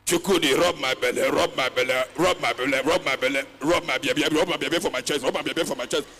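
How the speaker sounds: loud, droning mock plea, rub my belly over and over; loud parody of a pleading partner; mock lover's plea. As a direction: ham up a needy lover begging Chukwudi.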